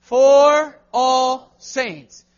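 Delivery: slow, loud unison reading; slow loud call-and-response; congregation reading aloud. Shown as a demonstration. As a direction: drag each word out together. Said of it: "Talk to me, everybody".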